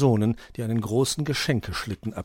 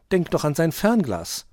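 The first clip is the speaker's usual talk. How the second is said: raised, louder urgent plea; imploring; 8 semitones higher, 5 dB louder than usual. A narrator's reading. Grünäuglein pleads with Dominik about the master's telescope, imploring. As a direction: implore him to remember. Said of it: "beschwor ihn Grünäuglein".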